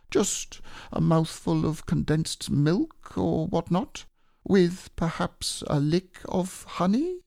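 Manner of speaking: hint at wanting a snack; Pooh voice, longing. Acted.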